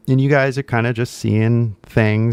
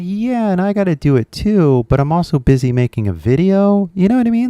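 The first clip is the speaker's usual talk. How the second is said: much higher, mock-busy voice; high joking voice; 9 semitones higher than usual. The speaker mocks being too busy, much higher. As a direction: squeak it out jokingly. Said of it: "And it's like".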